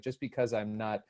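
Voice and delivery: quiet, contained retelling of a stoic woman; understated, stoic reply. Retold or imitated. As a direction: keep it low and soft, holding feeling back. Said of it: "like, very stoic".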